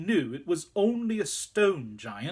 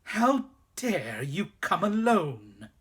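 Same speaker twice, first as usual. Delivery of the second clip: slow, louder icy rage; terrible voice; 4 semitones higher, 4 dB louder, slower than usual. A narrator's reading. Witch scolding the boy for coming alone, slow fury. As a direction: let each word fall with cold fury. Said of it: "in a terrible voice".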